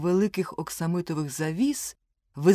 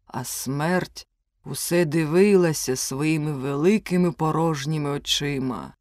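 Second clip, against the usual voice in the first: ordinary delivery, nothing marked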